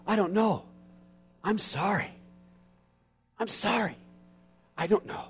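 soft, hushed i don't know, i'm sorry; gentle condolence. Shown as a demonstration. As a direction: say it softly to the grieving family. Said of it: "right there to say".

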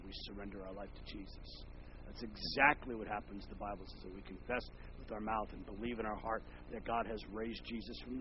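very quiet, intimate sermon; hushed preaching. Acted. Pastor quietly telling listeners of salvation, hushed. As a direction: lean in and speak softly.

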